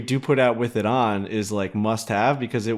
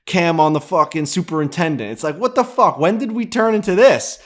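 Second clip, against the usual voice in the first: rising, higher-pitched incredulous complaint; exasperated rant; 6 semitones higher, 4 dB louder than usual. Real, not acted. Editor griping to co-hosts about endless multicam footage, exasperated. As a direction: build to a loud, high, baffled protest.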